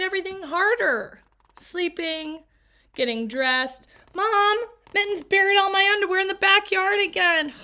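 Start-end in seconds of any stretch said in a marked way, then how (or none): 4.1-7.5 loud, high whiny protest; exasperated complaint. Acted. Girl whining loudly to her mom about the pet.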